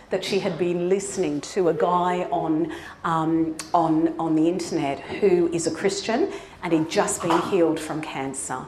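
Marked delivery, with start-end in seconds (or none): none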